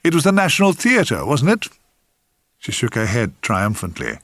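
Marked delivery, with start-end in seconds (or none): 0.0-1.7 bluffing confidence, lifting high midway; terrific confidence. A narrator's reading. Narrator bluffs to the woman he has forgotten, confidently. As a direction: bluff it out with a show of certainty. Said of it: "I said with terrific confidence".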